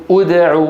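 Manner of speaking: أدع started with u, "ud'u"; opening damma. Shown as a demonstration. Teacher showing how we begin the verb, with damma. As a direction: begin أدع on a clear u sound. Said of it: "فنبدأ بالهمزة نقول".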